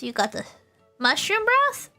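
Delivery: ordinary delivery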